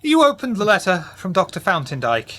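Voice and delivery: ordinary delivery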